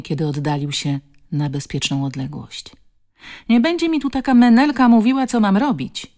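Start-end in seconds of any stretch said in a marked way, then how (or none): 3.5-6.1 high, loud, quick outburst; threatening. A narrator's reading. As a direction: rant back defiantly at the woman. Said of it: "odgrażał się".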